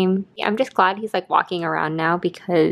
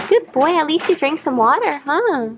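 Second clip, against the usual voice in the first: higher sing-song voice for the dog; pet talk; 6 semitones higher than usual. Real, not acted. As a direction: coo at the dog, pitched up. Coaxing the sick dog.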